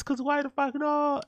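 a mock squeal for the punchline; squeaky high voice. A host tossing off a cheeky rhetorical question, squeaky and high. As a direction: jump way up high and slow it down.

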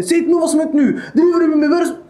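hammed-up irritated husband, hurried; mock stressed snapping. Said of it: "Du ska bli stressad med henne". (spelat stressad och irriterad)

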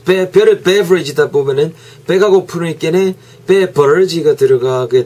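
memory joke in a broad regional Korean dialect; sling it out in a broad regional dialect; playful dialect. Pretend. A teacher doing a memory joke in a Gyeongsang dialect, playfully. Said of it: "사투리 좀 덜 쓴".

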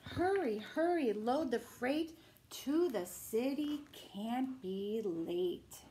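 gentle bedtime story reading; reading to children. Acted. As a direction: bedtime picture book read-aloud. Reading picture book aloud.

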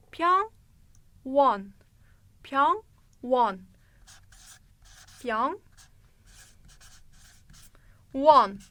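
Pyongwon said slowly in Korean; Korean pronunciation. Shown as a demonstration. Teacher models the word for hospital. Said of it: "hastane Korece nasıl söyleniyor?".

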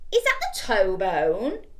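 little skeleton. Acted. Little skeleton puzzled, lightly voiced. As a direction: ask it slowly.

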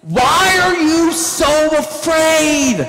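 high, very slow shouted question; acted shouted rebuke; yelling over storm. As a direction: draw out each word in a big call. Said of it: "they're all yelling".

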